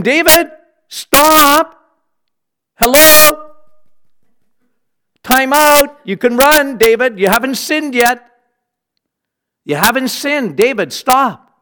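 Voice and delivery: high, loud urgent plea from God; God's voice shouting a warning to David; God pleading. Acted. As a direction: call David back before he falls.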